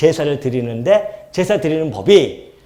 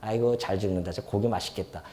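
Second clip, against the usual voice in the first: mock callous onlooker; 6 semitones lower, 9 dB quieter than usual. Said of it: "그렇게 생각이 되겠냐".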